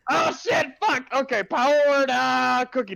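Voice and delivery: high, loud flustered backpedal; flustered yelp. Real, not acted. Streamer caught out by the host, high flustered yelp. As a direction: scramble to fix it in a panic. Flustered correction after scolding.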